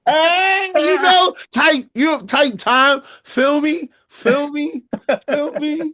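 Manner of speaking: squeaky high, drawn-out hype-guy voice; high comic persona voice. Pretend. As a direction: ham up a goofy high-pitched dude. Hammed-up persona, laughing replies.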